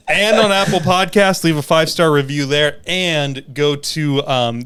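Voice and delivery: loud, upbeat show sign-off; podcast outro. Acted. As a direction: wrap up like a host.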